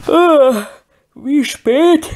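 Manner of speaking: loud yawning, just woken; sleepy. A narrator's reading. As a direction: stretch and yawn it out. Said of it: "fragte er verschlafen".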